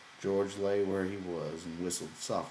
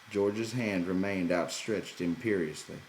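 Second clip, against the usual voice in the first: ordinary delivery, nothing marked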